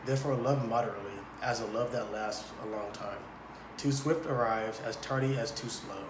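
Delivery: measured Shakespearean reading; a priest reciting verse to the couple; verse recitation. Acted. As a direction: declaim it like a solemn priest.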